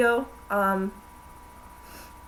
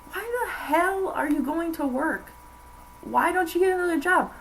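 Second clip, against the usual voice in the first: pitched-up retold exasperation; quoting an exasperated rant; 8 semitones higher than usual. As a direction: spell out the obvious in disbelief. Guy's rant quoted, continuing.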